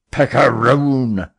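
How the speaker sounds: slow, loud raging insult; captain's furious outburst; angry insult. A narrator's reading. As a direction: fling it out in disgust. Captain insulting Fogg angrily.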